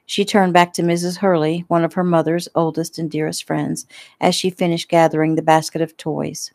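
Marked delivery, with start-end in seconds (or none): none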